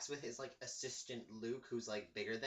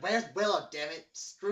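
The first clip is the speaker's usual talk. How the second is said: loud mock outburst; exasperated demand; 10 dB louder than usual. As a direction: explode with impatience, hitting it hard. Retold quarrel, demand repeated angrily.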